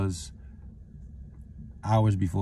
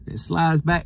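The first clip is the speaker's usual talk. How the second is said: ordinary talk, nothing marked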